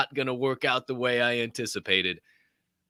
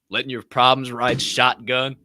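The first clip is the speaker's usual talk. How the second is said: ordinary delivery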